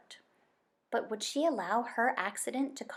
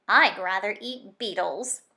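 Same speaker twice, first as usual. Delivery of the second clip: grumbling; 8 dB louder than usual. Acted. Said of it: "he grumbled to himself".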